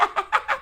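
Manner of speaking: very high giggle; lizard laugh. Acted. Lizard laughing at Toad. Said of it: "The lizard laughed".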